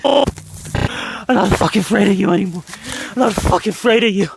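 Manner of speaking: frantic hammed-up horror plea; being chased. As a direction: beg them to listen, panicked and fast. Acted found-footage plea, being chased.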